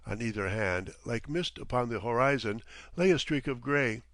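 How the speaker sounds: plain reading voice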